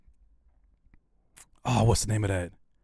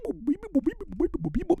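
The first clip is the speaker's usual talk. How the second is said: high beeping; robot beeps; 5 dB quieter than usual. Retold or imitated. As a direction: chirp like a machine. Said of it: "nothing but beep".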